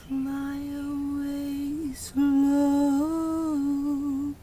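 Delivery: ordinary talk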